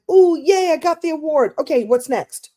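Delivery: high, loud quick yay, then straight on; a flash of glee that moves on at once; mock excited. Presenter mimicking people who rush past wins, high mock glee. Acting a quick, hollow celebration.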